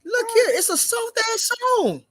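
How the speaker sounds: very high, exasperated insistence; exasperated protest. Real, not acted. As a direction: pitch it up high to argue the point. Heated argument about the song.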